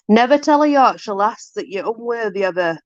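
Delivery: usual speaking voice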